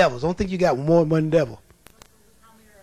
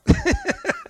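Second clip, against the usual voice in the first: high laugh. Real, not acted. Preacher laughs at demons' fear.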